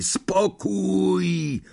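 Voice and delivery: high, strained, hoarse call; hoarse booming command. A narrator's reading. Lapin silences the noisy compartment, hoarse command. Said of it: "zagrzmiał ochrypły głos".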